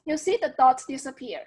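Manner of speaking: usual speaking voice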